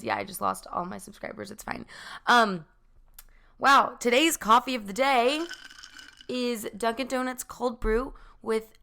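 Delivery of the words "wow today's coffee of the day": ordinary talk, nothing marked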